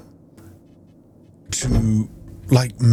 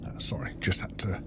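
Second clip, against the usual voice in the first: soft unvoiced whisper, barely any voice; 3 semitones lower, 11 dB quieter than usual. Real, not acted. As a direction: whisper the apology so nobody nearby is disturbed. Quick apology mid-gameplay.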